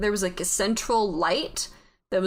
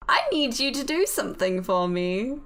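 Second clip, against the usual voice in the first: put-on horrible British accent. Voice in the head asks the player a favour, bad British accent. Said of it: "My horrible British accent".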